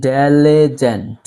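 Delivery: diligent stretched out very slowly, syllable by syllable; slow model pronunciation. Shown as a demonstration. Teacher modelling a word for students, very slow. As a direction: spell out diligent slowly for the class. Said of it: "আমার সাথে বলার try করেন".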